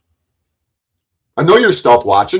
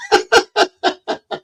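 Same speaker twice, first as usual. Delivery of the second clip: high burst of laughter; laughing; 3 dB quieter than usual. Real, not acted. Streamer laughs at a predicted insult, high laughter. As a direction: laugh in a high, broken run. Laughs at the paper tiger jibe.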